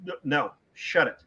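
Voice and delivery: ordinary delivery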